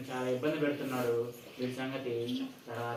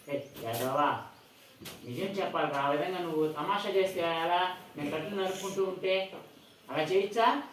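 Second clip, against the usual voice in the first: scolding; 5 semitones higher, 4 dB louder than usual. Acted. Father scolds son for lying.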